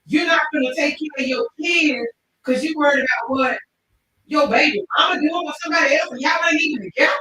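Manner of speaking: high, loud, fast imitated rant; retold rant. Driver berating a deadbeat dad, loud fast rant. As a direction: rant at him like the furious driver. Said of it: "He said".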